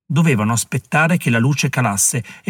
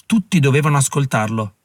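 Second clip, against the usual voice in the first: ordinary delivery, nothing marked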